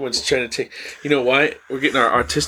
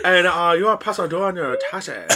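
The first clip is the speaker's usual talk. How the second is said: mock Bangladeshi accent; 4 semitones higher than usual. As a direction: fake Bangladeshi tax caller, nearly laughing. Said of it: "can't do that accent".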